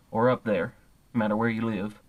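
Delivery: usual speaking voice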